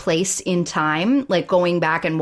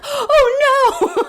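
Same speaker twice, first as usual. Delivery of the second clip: high, louder hammed-up wail; mock crying; 5 dB louder than usual. Co-host imitates Cameron crying in the background, mock crying. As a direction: imitate a man bawling in the background. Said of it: "like, crying in the background".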